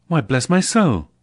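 loud, startled exclamation; surprised nephew crying out; cry of surprise. Acted. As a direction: jump up startled at the door. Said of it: "cried Fred".

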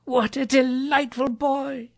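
high, slow relish; elated admiration; delighted Scrooge. A narrator's reading. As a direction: gush with delight, drawing it out high. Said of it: "said Scrooge".

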